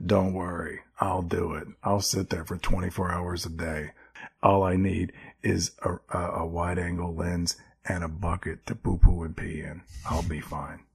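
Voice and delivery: very low, hushed, intense character voice; put-on low monotone, barely above a whisper; Michael Shannon. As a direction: murmur it deep and deadly serious. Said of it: "If I can have Michael Shannon being like".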